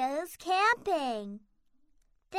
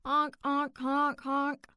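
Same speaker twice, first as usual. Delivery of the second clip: horn honking; 3 semitones lower, 4 dB quieter than usual. Acted. Clown horns honking.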